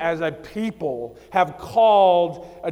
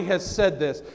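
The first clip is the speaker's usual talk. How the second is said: ordinary delivery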